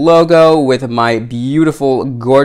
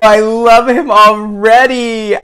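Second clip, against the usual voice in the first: high, loud cry of joy; excited shout; 9 semitones higher, 5 dB louder, slower than usual. Real, not acted. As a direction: shout out love at first sight. First sight of the doll.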